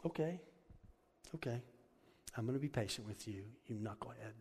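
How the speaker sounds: put-on calm a stubborn child; patient God. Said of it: "He's just".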